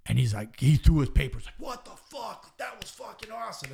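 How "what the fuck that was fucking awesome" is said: high, hushed mock exclamation; stifled falsetto shout of disbelief; amazed outburst. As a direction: imitate his astonishment in a high, held-back yell. Retold amazed reaction to the promo.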